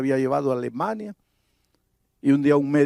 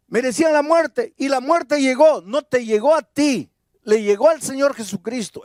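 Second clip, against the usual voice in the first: higher and louder, driving sermon climax; fervent pastor proclaiming forgiveness; full-force preaching; 10 semitones higher, 4 dB louder than usual. Acted. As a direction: preach it out to the congregation, building.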